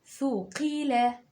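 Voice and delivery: "ثقيلة" very slow; teacher dictating slowly; slow dictation. Shown as a demonstration. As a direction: stretch "ثقيلة" for writing. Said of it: "اكتب معايا".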